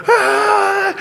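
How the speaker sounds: loud, very high mock shriek; terrified scream. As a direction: scream like a spooked apostle, hammed up. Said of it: "They're terrified".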